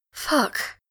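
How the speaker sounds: breathless, voiceless curse; panting. A narrator's reading. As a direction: gasp it out of breath. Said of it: "Grantaire pants".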